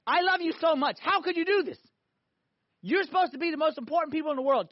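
high, loud, impassioned rebuke; angry, loving God. Acted. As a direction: scold like a hurt, loving father. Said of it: "when God gets angry".

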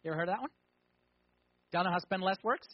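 ordinary delivery

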